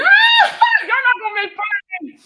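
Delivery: high, loud, slow playful yell; mock outraged shout. Real, not acted. Streamer shouting back at viewers who mock her accent, mock outrage. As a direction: yell back at the teasing chat.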